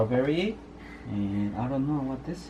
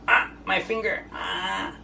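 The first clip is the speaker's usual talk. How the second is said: high, playful fake ouch; mock pain; 8 semitones higher, slower than usual. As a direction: pretend the bite hurts. Toy alligator bites dad's finger.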